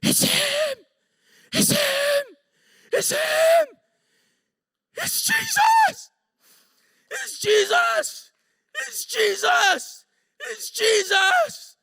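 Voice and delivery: full-throated, very high frantic shouts; shouting. Retold or imitated. Shaking coworker shouting out who he sees, frantic shout. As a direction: yell it over and over, stretching the words. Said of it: "he just starts to shout".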